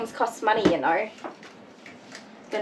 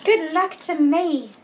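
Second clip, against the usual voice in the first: wry sing-song; 9 semitones higher, slower than usual. Real, not acted. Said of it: "All I can say is".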